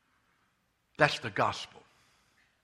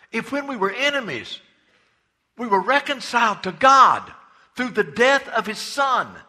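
high, loud scripture proclaimed; preaching at full force; 8 semitones higher, 8 dB louder than usual. Acted. The preacher reads Romans to the church at full force. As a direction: thunder the verse out to the church.

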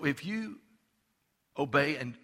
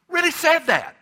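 much higher and louder, indignant; outraged preaching; 8 semitones higher, 7 dB louder than usual. Real, not acted. Preacher to congregation, appalled by a candidate's words, loud and high. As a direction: let the outrage rise as you repeat it.

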